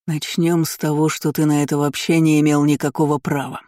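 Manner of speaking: plain reading voice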